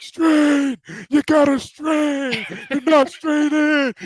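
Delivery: high, loud, slow coach's bark; Coach Heater impression. Former player imitating his coach yelling at linemen to strain, high and loud. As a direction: bellow it like an old-school defensive coach. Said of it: "like, the impression too".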